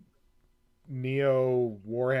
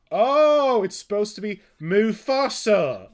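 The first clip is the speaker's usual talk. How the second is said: put-on high, loud, slow Cockney English accent; hammed-up Cockney English accent, drawn out; 7 semitones higher, 10 dB louder, slower than usual. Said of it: "a crazy Cockney English accent".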